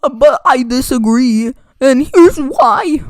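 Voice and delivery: loud, high mocking whine of an argumentative fan; mocking essay writer. Imitating fans defending Dark Souls two in long essays, mockingly. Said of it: "don't make some long essay saying".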